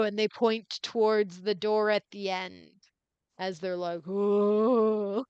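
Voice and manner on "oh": zombie moan. Acted. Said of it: "they continue to shamble".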